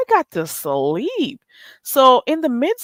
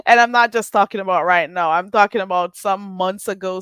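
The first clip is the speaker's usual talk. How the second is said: ordinary talk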